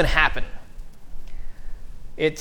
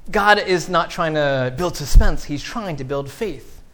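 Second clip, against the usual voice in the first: ordinary delivery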